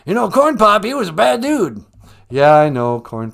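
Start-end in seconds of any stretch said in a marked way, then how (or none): 0.1-1.8 loud, higher blustering politician; Joe Biden. Acted. President rambling to the host about a rival, blustering. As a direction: launch loudly into the old yarn. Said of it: "President Joe".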